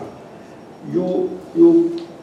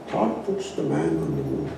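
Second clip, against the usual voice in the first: much softer and lower, almost to himself; quiet; 10 semitones lower, 10 dB quieter than usual. Shown as a demonstration. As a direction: drop the voice right down. Repeats the line, much softer.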